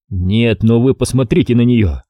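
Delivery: usual speaking voice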